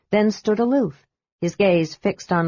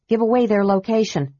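usual speaking voice

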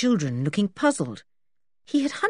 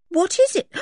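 high, loud startled gasp; 9 semitones higher, 3 dB louder than usual. Acted. Dick finding George's dressing gown belt, startled gasp. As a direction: gasp as you recognise it.

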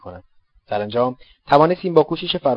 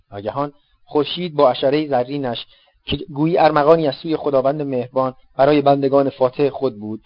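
usual speaking voice

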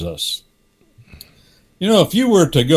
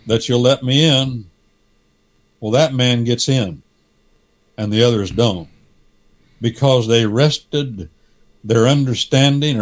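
normal talking voice

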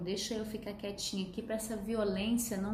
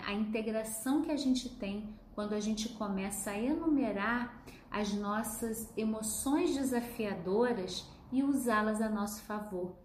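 ordinary talk, nothing marked

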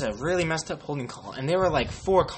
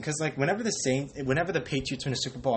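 normal talking voice

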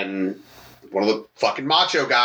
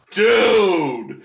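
stunned dude; 5 semitones higher, 3 dB louder than usual. Retold or imitated. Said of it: "I was like".

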